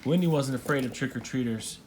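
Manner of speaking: plain reading voice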